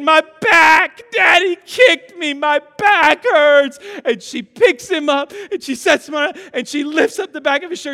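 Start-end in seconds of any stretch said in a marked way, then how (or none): 0.0-3.8 loud, high mock crying; wailing hurt child. Dad imitating son complaining to mom, hammed-up wail. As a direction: bawl it out like an injured little boy.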